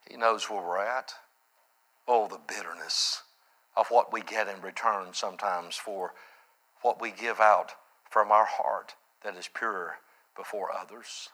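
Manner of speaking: low, soft, reflective sermon voice; hushed, solemn preaching. Real, not acted. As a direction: let it sink down quietly with pauses.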